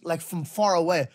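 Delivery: normal talking voice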